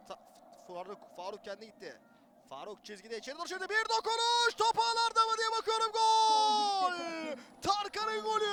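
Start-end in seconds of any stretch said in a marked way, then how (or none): 2.5-8.5 rushing commentary into goal scream; building to a goal shout. Real, not acted. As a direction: speed up then shout goal.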